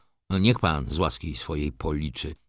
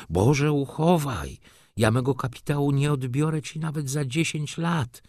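put-on aghast old aristocrat; 7 semitones higher than usual. Old nobleman horrified, pleading with the merchant. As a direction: recoil in mock horror, stretched out. Tomasz aghast at withdrawing capital.

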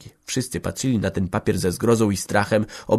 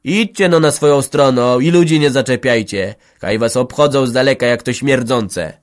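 louder, higher angry rebuke; growled rebuke; 4 semitones higher, 7 dB louder than usual. Acted. Old woman driving off the village head's wife, angry. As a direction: snap her away. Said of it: "warknęła stara".

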